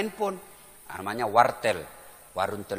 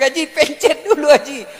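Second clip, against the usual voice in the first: high, loud retold correction; exasperated correction; 10 semitones higher, 7 dB louder than usual. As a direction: call out to a clueless caller, exasperated. Retelling exasperated correction of caller. (seruan gemas, keras dan tinggi)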